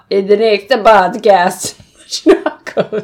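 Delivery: sudden put-on Italian-flavoured accent, breaking into a laugh; hammed-up vaguely Italian accent; playful Italian-ish accent. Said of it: "What was that accent?".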